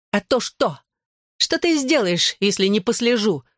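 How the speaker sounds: high, spiteful taunt; hissed challenge. A narrator's reading. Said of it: "прошипела Белла". (язвительно, с вызовом, высоким голосом)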